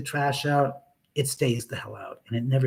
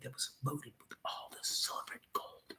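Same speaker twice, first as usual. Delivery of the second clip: whisper; 18 dB quieter than usual. Real, not acted. Hushed aside about buried treasure.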